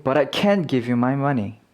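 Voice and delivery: CAN'T stressed with a full vowel. Shown as a demonstration. Teacher contrasting negative with positive, stressed can't. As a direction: lean hard on 'can't', then fall. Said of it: "강세가 있으면 부정일 때가 많다".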